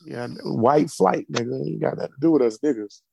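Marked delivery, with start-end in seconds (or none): none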